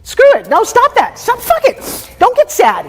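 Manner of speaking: high-pitched, urgent outburst; forceful goading. Real, not acted. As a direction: cut in hard, pushing them. Coach goading client out of sadness.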